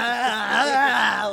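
loud, long, high "aah" with tongue out; tongue-out yell. Retold or imitated. Imitating the comic's pussy-eating act, loud tongue-out yell. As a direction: mimic a lewd tongue-waggling holler. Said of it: "with his tongue out".

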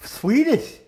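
put-on high, loud Swedish accent. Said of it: "let me hear your best Swedish accent".

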